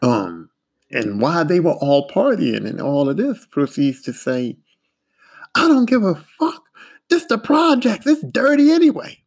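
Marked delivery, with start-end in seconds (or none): 5.6-9.2 high-pitched mock outburst; dismissive outburst. Foreman brushing off the dirt in front of partiers, dismissive. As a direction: blurt it high, not caring.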